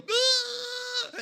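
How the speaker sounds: loud, very high open-mouthed wail; shocked yelp. Acted. Pupil caught whispering by the teacher, shocked high cry. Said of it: "you were so shocked that you got caught, you'd go".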